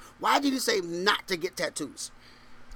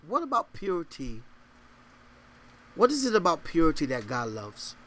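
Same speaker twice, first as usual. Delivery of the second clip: ordinary talk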